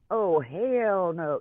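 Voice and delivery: a playful Southern "Oh, hell no" from a Texas transplant. Shown as a demonstration. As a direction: drawl "hell no" like a sassy Southerner. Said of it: "I say that regularly".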